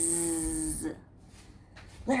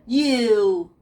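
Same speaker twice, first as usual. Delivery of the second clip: louder mock angry you; 8 dB louder than usual. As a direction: scold the rock. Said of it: "she got mad".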